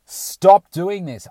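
slow, loud stop to your own thoughts; loud self-command. Retold or imitated. Telling his own thoughts to stop, loud and slow. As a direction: snap yourself out of it, loud and slow. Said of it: "I did it out loud".

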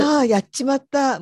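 a light Fukushima はあ before やっちまった; Nakadori はあ. Shown as a demonstration. Giving an example of the Nakadori filler, in a light Fukushima lilt. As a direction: let はあ hang before the words. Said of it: "はあって言うんですよ".

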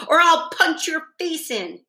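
high-pitched angry threat; snapping threat. A narrator's reading. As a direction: threaten a big sister hotly. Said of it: "he snapped at her".

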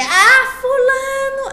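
calling a name out loud in annoyance; yelling. Pretend. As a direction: shout the name across the house. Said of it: "Fui gritar".

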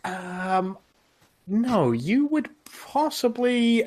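high, slow barmaid voice. Acted. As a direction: answer kindly. Innkeeper answers smoker.

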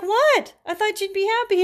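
high, loud disbelief; bewildered, voice shooting up; surprised. Retold or imitated. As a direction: caught off guard by the reaction. Her confused reply to client.

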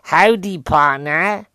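loud, high Dallas cowboy accent; American accent. Shown as a demonstration. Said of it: "I do American accents now".

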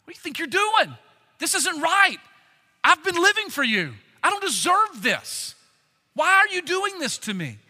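imitating an aggrieved man calling God to account; indignant demand. Job calls God on the carpet over his suffering, indignant. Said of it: "called God on the carpet".

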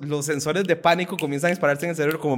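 ordinary talk, nothing marked

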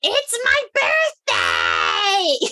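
high, slow mock shriek; hammed-up audience member's scream; imitated screaming. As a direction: screech it out like a heckler, stretching the words. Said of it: "these w-, women start screaming out".

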